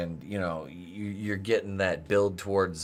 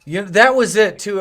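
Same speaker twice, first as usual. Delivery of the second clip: ordinary delivery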